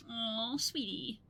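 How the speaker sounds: ordinary talk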